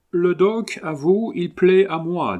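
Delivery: put-on an excited English gentleman warning the host, thick English accent; strong English accent. A narrator's reading. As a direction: fort accent anglais. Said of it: "avec un accent anglais fortement prononcé".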